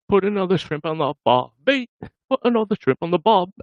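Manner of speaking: hammed-up Australian accent, pitched high; mock Australian accent. Shown as a demonstration. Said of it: "sitting making fun of somebody".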